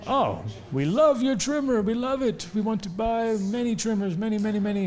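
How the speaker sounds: high, sugary put-on buyer voice, pitched well up; mock Russian buyers. Russian buyers flattering the trimmer inventor, high mock gushing voice. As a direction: gush over the trimmer like eager foreign buyers. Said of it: "these Russian guys came and said".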